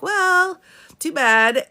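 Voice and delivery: high, loud brush-off; mock dismissive. Embassy staff turning away the film heroine, mock dismissive.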